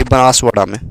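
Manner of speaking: usual speaking voice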